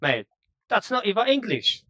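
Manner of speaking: high, scoffing Scouse jab; imitating a local brushing off a foreigner; mock Scouser. Liverpool local scoffing at a foreigner's English, mocking Scouse voice. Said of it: "when you speak with the proper Scalzers".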